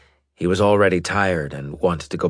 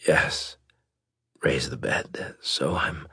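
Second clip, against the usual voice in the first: soft unvoiced whisper; weak, breathy whisper; 2 semitones lower, 5 dB quieter, slower than usual.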